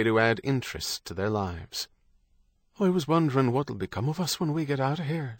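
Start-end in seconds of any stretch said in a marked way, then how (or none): 2.8-5.4 higher, quick wondering voice; voiced Kate, wondering. A narrator's reading. Kate asks Stephen about getting out, higher wondering voice. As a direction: voice a woman wondering about what comes next.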